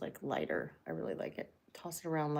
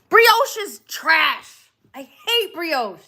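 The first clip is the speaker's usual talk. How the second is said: high, slow, dramatic declaration; exaggerated disgust; 10 semitones higher, 9 dB louder, much slower than usual. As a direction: drag it out like a verdict.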